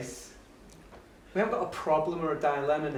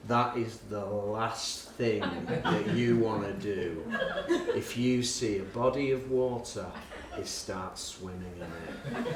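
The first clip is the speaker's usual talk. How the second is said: lower, softer and slow, grave warning with a laugh behind; solemn kid warning; 7 semitones lower, 6 dB quieter, slower than usual. Retold or imitated. Beaming boy warning the teacher, low and slow. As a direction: deliver it low and deadly serious.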